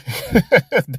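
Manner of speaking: a quick real chuckle; short real laugh. Real, not acted. Preacher laughing to himself mid-warning, short laugh. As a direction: laugh briefly at his own point. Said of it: "And I'm laughing".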